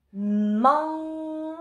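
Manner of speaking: "man" held long on a high, level note; syllable stretched out. Shown as a demonstration. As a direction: draw out the vowel of "man" for dictation. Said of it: "man, ce sera avec le an".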